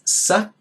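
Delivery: "sa" with the pitch going up; rising tone. Shown as a demonstration. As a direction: raise the tone sharply on "sa". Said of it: "That's telling you to raise your tone".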